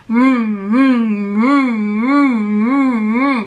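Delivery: loud droning vroom vroom imitation; vacuum noise. Telling viewers about husband vacuuming, loud droning vacuum imitation.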